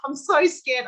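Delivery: high, loud, hammed-up scared little boy; nervous child. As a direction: imitate your son anxiously pleading with mum. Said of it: "he'll be like".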